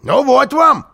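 high, loud crow of victory; triumphant. A narrator's reading. Karlsson scoring over the housekeeper, triumphant. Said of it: "сказал Карлсон с торжеством".

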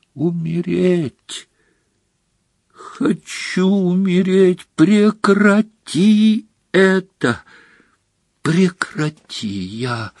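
slow, high, drawn-out wail of agony; anguished wailing. A narrator's reading. Dumbledore in agony from the potion, anguished wailing. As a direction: plead to die in slow, tormented cries. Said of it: "завопил".

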